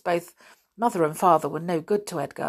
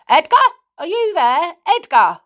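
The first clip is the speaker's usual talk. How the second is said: high, loud searching call; calling out loudly; 12 semitones higher, 10 dB louder than usual. A narrator's reading. Missus Stick calling to her son in the cave, loudly. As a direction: call through a bolted door. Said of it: "calling out loudly".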